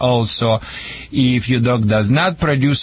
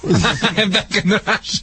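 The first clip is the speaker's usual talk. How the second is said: laughing through the words, pitched up; higher, amused laugh in the voice; 5 semitones higher than usual. Real, not acted. Joking about Russia, laughs.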